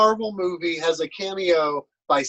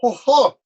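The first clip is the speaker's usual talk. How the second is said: surprised hoot; 6 semitones higher, 8 dB louder than usual. Acted. Tasting spicy food, exclaims.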